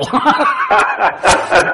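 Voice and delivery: real burst of high laughter. Real, not acted. Host cracks up retelling his story to listeners, laughing high. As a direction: break into helpless laughter at the punchline.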